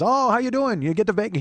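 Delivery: high, loud, eager hello; imitating friendly greeting. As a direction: mimic a chummy store clerk's bubbly greeting. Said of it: "He's just a really friendly, nice man".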